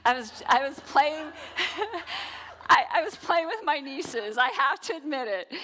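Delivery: sheepish laughing admission. Real, not acted. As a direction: giggle through the confession. Laughing confession about nieces.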